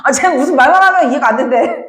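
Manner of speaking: ordinary talk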